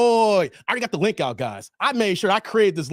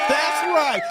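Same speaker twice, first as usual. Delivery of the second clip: triumphant shout; 8 semitones higher than usual. Real, not acted. Confirming to viewers it's his birthday, proud shout. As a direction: land the reveal.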